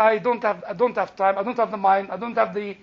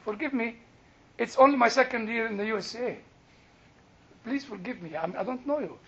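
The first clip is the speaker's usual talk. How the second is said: soft, lowered, apologetic voice; meek apology; 7 dB quieter, faster than usual. Retold or imitated. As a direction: act out a humble apology, very softly. Acts his meek apology to celebrity.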